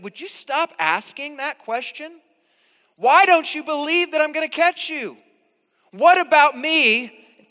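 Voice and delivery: put-on high, loud exasperated yell at a child; mock yelling at son. Dad yelling at son on the diving board, mock exasperated yell. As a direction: blast it out like a fed-up dad losing patience. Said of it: "Like yelling at him".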